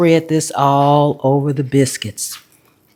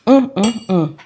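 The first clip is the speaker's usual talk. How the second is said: savoring hum; 3 semitones higher than usual. Real, not acted. Tasting the biscuit.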